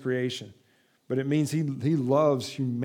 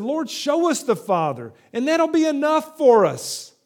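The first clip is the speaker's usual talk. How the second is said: pleading Philip; 9 semitones higher, 6 dB louder than usual. Acted.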